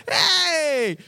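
high, drawn-out holler; shouted greeting. Acted. Kid turning around to greet a friend, high shouted hey. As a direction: call back eagerly to a friend.

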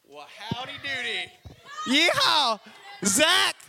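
high, drawn-out yeehaw cowboy whoop. Pretend. Mocks the guest's Mississippi accent.

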